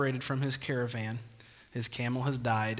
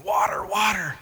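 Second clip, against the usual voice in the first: higher, strained croak of a parched man; 6 semitones higher, 3 dB louder than usual. Acted. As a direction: croak it out after days without water. Man back days later, desperate.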